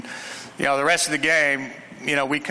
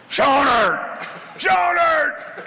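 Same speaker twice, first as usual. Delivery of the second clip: loud, high bellow of a name across the sideline; 11 semitones higher, 3 dB louder, much slower than usual. Retold or imitated. Coach bellowing for the backup quarterback.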